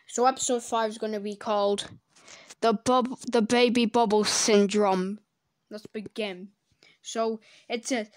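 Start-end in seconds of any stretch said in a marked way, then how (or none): none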